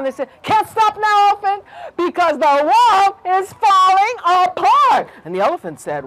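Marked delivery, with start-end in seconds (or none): 0.4-5.1 high, loud, drawn-out alarm; panicked warning. A narrator's reading. Fleeing animals warning the elephant, panicked cry. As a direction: cry it out without stopping.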